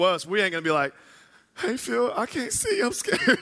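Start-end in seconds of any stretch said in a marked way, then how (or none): 1.6-3.4 high, whimpering mock plea; hammed-up tearful little squeak; mock scared. Mocking a scared man in woods.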